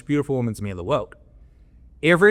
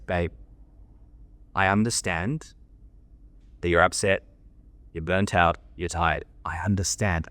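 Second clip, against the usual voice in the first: calm understanding voice; 6 semitones lower than usual. Shown as a demonstration. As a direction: soothe her gently without giving ground. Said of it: "I speak exactly like this".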